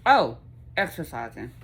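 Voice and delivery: normal talking voice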